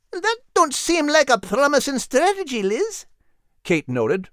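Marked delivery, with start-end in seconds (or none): 0.1-3.0 very high, small Scottish dog voice; a tiny Scottish terrier speaking gently; whimpering little dog. A narrator's reading. Kate frowns.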